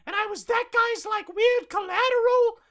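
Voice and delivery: put-on high, loud whiny kid; whiny child rant. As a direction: rant like an outraged little boy. [name] ranting.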